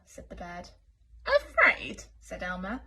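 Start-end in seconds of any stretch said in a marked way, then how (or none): 1.2-2.0 high, loud scoffing "Afraid?"; incredulous. Acted. Elmer scoffing at the bird, high and loud. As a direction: toss the word back in disbelief.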